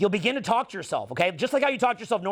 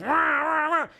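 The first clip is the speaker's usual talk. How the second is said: mock engine revving; 7 semitones higher, slower than usual. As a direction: rev it like tyres spinning in mud. Imitating wheels spinning in mud.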